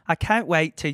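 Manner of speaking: unsure attempt at sounding Australian; Australian accent. Shown as a demonstration. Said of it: "that was a good Australian accent".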